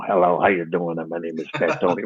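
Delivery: low understated mobster greeting; Fat Tony voice. Retold or imitated. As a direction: sink low into the wiseguy character. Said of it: "And I go".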